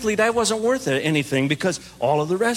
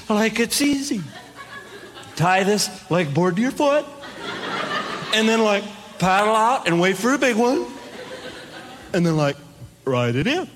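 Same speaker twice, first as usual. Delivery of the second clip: California surfer. Retold or imitated. Muscly surfer instructs beginner, mock California surfer. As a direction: imitate a surfer casually explaining. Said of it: "I'm in California".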